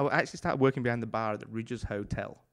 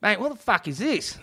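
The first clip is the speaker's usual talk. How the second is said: indignant customer in a put-on Aussie accent, high and loud; rough Aussie accent; 10 semitones higher, 7 dB louder than usual. Aussie customer to barman over the wrong drink, rough Aussie accent. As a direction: badly imitate an irate Aussie. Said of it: "I'm not very good at Aus-, Aussie accent".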